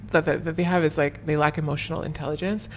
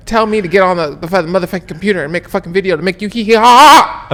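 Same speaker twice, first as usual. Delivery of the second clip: loud, hammed-up tirade; comic outburst ending in mocking laughter; mock rant; 10 dB louder than usual. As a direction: berate the fans at full volume. Mock rant at demanding fans.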